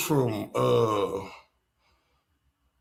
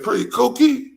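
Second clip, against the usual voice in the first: higher, mock baby voice; imitating her toddler; 11 semitones higher, 6 dB louder than usual. Mother quoting her son on the live, pitched-up mock baby voice. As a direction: sound like her little boy. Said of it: "He was on here saying".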